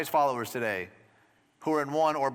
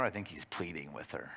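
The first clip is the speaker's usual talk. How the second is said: much lower and softer, almost hushed; hushed aside; 10 semitones lower, 10 dB quieter than usual. Real, not acted. Pastor to congregation, hushed and low. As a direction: drop it right down, intimate.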